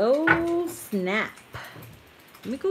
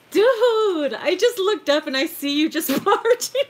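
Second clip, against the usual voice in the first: laughing, still startled; 9 semitones higher, 8 dB louder than usual. Real, not acted. Telling husband how he startled her.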